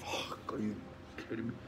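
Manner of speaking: breathless whispered disbelief; stunned whisper. Retold or imitated. A man hearing his girlfriend is pregnant again, whispered in shock. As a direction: whisper it like the news just knocked you flat. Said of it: "And I'm like".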